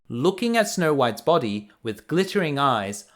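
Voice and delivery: ordinary delivery, nothing marked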